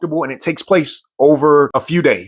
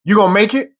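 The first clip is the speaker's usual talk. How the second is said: drill instructor call; 7 semitones higher than usual. Retold or imitated. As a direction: test whether the recruit will quit. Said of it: "he comes back and says".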